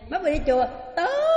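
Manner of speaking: loud and high, booming praise; hearty approval. Retold or imitated. Husband loudly praises his wife for going to temple. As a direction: burst out in loud delighted approval.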